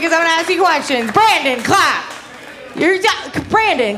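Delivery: ordinary talk, nothing marked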